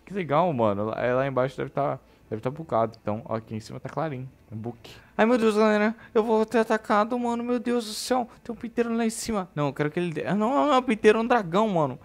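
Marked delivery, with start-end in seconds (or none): 5.2-12.0 high, loud scared yelping; scared shriek. Real, not acted. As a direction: yelp in fright at a dragon. Dragon appears, scared. (gritinho assustado agudo)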